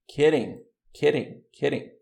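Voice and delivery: "kidding" with the t flapped like a Brazilian r; model pronunciation. Shown as a demonstration. Teacher modelling flapped "kidding" for the class. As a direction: tap the middle of "kidding". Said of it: "vamos ler então".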